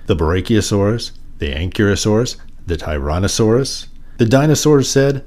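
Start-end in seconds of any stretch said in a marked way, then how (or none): none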